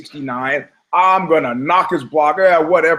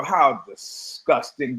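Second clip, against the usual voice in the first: disgusted aside; 3 semitones lower, 5 dB quieter, slower than usual. Retold or imitated. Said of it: "and I go".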